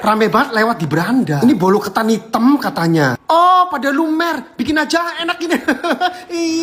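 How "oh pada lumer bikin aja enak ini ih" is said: excited giggling. Real, not acted. Excited over melting dessert.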